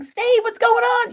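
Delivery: calling to Steve. Retold or imitated. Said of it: "I'm like".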